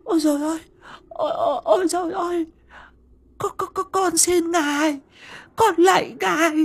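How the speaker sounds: high wailing plea for mercy; begging through sobs; terrified begging. Acted. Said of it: "méo mó van xin".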